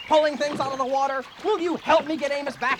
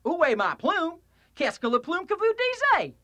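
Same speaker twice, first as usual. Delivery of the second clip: Texan French; 5 semitones higher than usual. Acted. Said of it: "speak French like a Texan".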